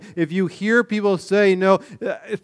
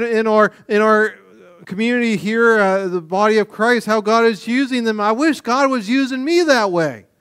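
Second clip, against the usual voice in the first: higher, louder envious complaining; mock envious whine; 6 semitones higher, 5 dB louder than usual. Pastor mimicking a jealous believer, mock whining. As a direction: whine like a jealous church member. Voicing envious church member.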